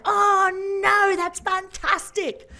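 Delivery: high, loud, drawn-out delight; thrilled exclamation. Retold or imitated. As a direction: blurt it out high and stretch "fantastic". Her thrilled reaction, acted out.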